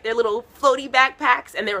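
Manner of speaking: ordinary talk, nothing marked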